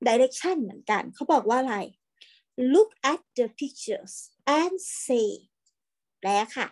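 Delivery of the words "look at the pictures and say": very slow, word by word; slow model reading. Shown as a demonstration. Teacher reading to online class, slow and clear. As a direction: read "I have a kite" slowly for children to repeat. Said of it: "อ่านตามด้วย".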